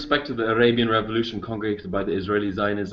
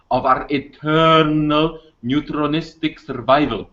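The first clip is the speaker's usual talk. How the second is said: louder, higher, drawn-out key words; slow, emphatic repeat; 4 semitones higher, 4 dB louder than usual. Real, not acted. Caller repeats his question for confused hosts, slow and emphatic. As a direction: spell it out slowly and forcefully, phrase by phrase. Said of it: "could you read it again slower".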